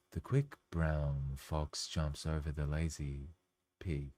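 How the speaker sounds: slow, low, soft guided-meditation read; hushed, unhurried meditation narrator. Shown as a demonstration. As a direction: lull the listener like a calm meditation guide. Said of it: "I said ridiculous things in that meditation voice".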